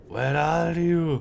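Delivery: high, slow, drawn-out where are you; playful high sing-song calling. Pretend. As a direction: call out like hide and seek.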